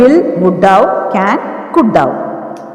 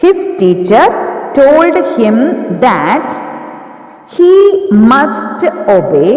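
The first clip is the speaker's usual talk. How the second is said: must obey, drawn out word by word for students to copy; slow dictation; 3 semitones higher, much slower than usual. Shown as a demonstration. Teacher dictating to the class, very slow and deliberate. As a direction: dictate it very slowly and evenly. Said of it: "dictating the model sentence slowly".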